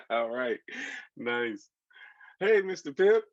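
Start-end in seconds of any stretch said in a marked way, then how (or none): 2.4-3.2 put-on high, loud child's call to a neighbour; screamed greeting. A narrator's reading. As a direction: call out his name with delight. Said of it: "Martine screamed".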